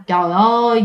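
plain reading voice

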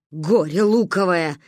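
slow grumbling reproach; scolding. A narrator's reading. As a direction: scold the child wearily. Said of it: "ругалась Ба". (ругаясь, с расстановкой)

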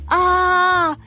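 long high held scream; loud drawn-out "aaah" cheer; excited scream. A narrator's reading. Teacher cheering from the stands, screaming. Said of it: "кричала Екатерина Михайловна".

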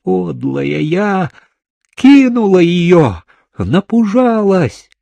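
high, loud self-reproaching cry; desperate wail. A narrator's reading. Girl blaming herself as men hold her, wailing desperately. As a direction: wail it out, climbing in pitch. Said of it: "отчаянно голосила она".

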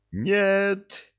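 high outraged shout; indignant yell. Acted. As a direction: yell Нет in protest. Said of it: "возмущённый вопль трёх лужёных глоток".

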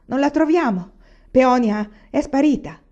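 high, loud, alarmed report; shouted reply. A narrator's reading. Servant reports Peonia missing, shouting back. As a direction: call the bad news back. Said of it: "che gridava in risposta".

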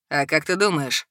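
cunning, teasing low voice; sly. A narrator's reading. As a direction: ask it slyly. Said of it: "Хитро поинтересовался он".

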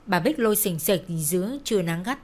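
usual speaking voice

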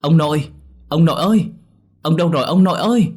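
high, loud calls for grandpa; panicked shouting. A narrator's reading. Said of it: "Tôi hét lên". (hét gọi ông thất thanh)

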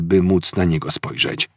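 ordinary delivery, nothing marked

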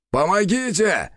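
high-pitched call for help; acted shout for help; cry for help. A narrator's reading. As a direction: call out high to a rescuer overhead. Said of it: "закричал орк".

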